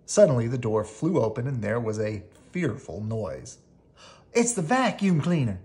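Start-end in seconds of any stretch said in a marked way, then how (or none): 4.3-5.6 frightened shriek. A narrator's reading. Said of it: "shrieked Melissa".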